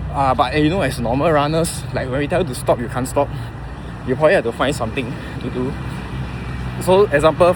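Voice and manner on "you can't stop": ordinary talk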